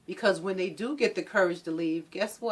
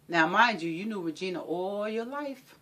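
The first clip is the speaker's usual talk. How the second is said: ordinary talk, nothing marked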